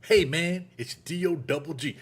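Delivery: lightly voiced laid-back [name]; a hint of [name]'s drawl; [name] impression. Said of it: "like, [name]'s voice talking to me".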